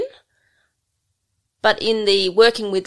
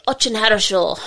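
higher, falsely cheery imitation; fake enthusiasm; 5 semitones higher, 4 dB louder than usual. Russian trainee fobbing off his instructor after a poor effort, fake enthusiasm. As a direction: imitate him with a big fake smile. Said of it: "did his big fake smile".

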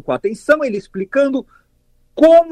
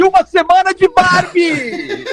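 indignant shout; 9 semitones higher than usual. Acted. Indignant punchline of a tweet.

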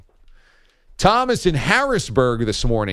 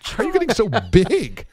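put-on baby talk; 10 semitones higher than usual. Talking to his baby son.

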